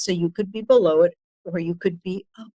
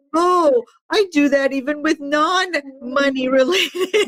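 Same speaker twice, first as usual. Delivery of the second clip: retold realization; 8 semitones higher, slower than usual. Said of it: "And I was like".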